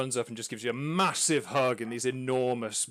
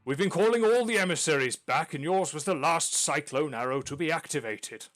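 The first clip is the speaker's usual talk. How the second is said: powerful voice; 6 semitones higher, 8 dB louder than usual. Acted. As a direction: address the party like a grand clan elder. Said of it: "given the power in his voice".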